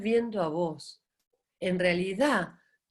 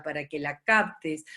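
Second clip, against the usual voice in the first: ordinary talk, nothing marked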